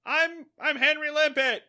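high, loud call up to a ship; imitated earnest shout. Fish calling up to navy ship.

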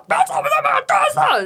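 high shouted stop; mock yell. Man yelling at the granny dumping trash, loud high shout. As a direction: yell after her to stop. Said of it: "他就大吼那个阿嬷".

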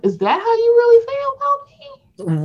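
high, wounded voice; mock hurt Robin. Robin asking the other housewife, high and hurt. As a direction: play her stung and disbelieving.

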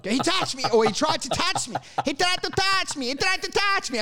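badly faked child's alarm, building in pitch; mock accusing yell. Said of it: "you just keep yelling".

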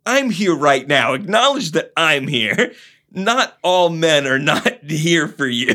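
high, loud needy man; mock his neediness; mocking Phil. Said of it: "Phil is like".

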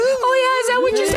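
very high squeaky nerd voice; mock them like a whiny nerd; nerdy voice. Shown as a demonstration. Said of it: "respond back in a nerdy voice".

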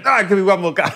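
high, loud pleading; eager insisting. Retold or imitated. Sister begging for another go at the coffin, high and loud.

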